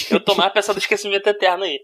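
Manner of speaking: normal talking voice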